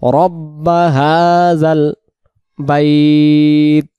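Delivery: বাইত drawn out and the breath let go; slow রব্বা হাযাল বাইত ending in a held বাইত; stretch then release. Shown as a demonstration. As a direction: hold বাইত long, then stop for breath. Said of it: "বাইত টান দিয়ে আমরা দম ছেড়ে দেব".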